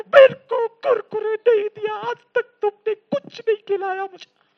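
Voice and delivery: put-on high, loud childish screaming tantrum; tantrum yell. Child throws a tantrum on the mall floor at mother. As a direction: wail and kick on the floor. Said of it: "हाथ पैर मार के चिल्ला के".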